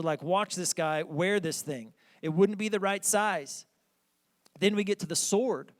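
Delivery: normal talking voice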